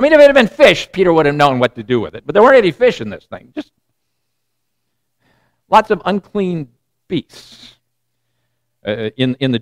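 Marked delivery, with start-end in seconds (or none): none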